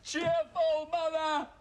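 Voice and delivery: very high, drawn-out cry; acted outburst to mother. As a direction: call out to Mother, slow and high. Performed scene between mother and son.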